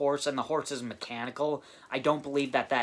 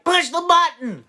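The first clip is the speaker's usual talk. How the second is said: put-on loud, much higher yelled southern sheriff accent; southern sheriff shout; 12 dB louder than usual. Reviewer quoting the fake sheriff's southern accent, yelled. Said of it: "It's a southern accent".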